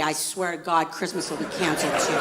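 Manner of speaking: hushed dad threat; quiet threat. Acted.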